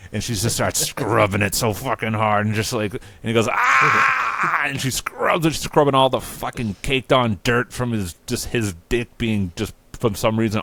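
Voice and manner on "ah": long high mock scream; hammed-up wail of pain. Will being scrubbed far too hard, screaming.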